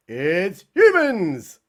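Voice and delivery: doom-laden slow reveal; slow dramatic reveal. Acted. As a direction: drag it out, very slow and heavy.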